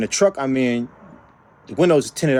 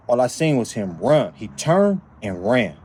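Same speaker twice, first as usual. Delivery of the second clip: ordinary delivery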